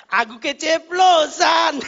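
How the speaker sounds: high, loud comic confession; imitated outburst. Driver admits his slip to the pastor, loud comic outburst. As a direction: blurt it loudly, stretched out.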